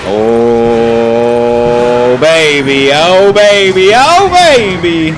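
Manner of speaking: ecstatic celebration. Real, not acted. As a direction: savour the comeback, drawing it out. Comeback win celebration.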